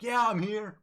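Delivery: high, loud call from far off; mock crewman hollering his presence; imitated distant shout. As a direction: shout from the other end of the battlefield. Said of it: "shouts, like".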